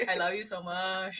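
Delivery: soft, murmured lovey line with a laugh in it; Syed impression. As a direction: coo it like Syed would, half laughing. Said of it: "Are you Syed? [name] all of a sudden is Syed".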